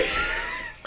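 helpless high-pitched laughing fit; cracking up uncontrollably; real squealing laughter. Real, not acted. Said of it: "I'm laughing at that".

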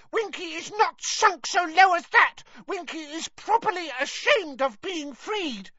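very high squeaky elf, indignant; furious Winky elf. Acted. Winky insists she is ashamed of being freed, furious squeak. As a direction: squeal with wounded pride.

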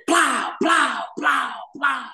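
shouted high plow plow; cap gun noises. Retold or imitated. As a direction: kid firing cap guns, shouting plow plow. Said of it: "they go".

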